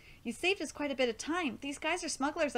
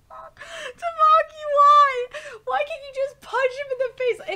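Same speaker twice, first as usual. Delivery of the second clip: high, loud, stretched-out lament; exasperated wail; 13 semitones higher, 13 dB louder, slower than usual. Real, not acted. Fan pleading with Tamaki over the fight, wailing. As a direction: wail the name and plead loudly.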